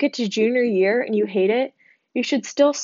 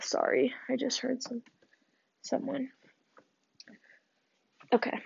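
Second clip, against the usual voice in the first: hushed aside; 4 semitones higher, 10 dB quieter than usual. Real, not acted. Distracted by a noise nearby.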